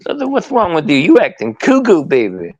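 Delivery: high scolding that drops on the last word; baffled telling-off with a punched CUCKOO; Dusty impression. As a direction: look down and chide the groveller. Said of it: "impersonations of guys besides Dusty".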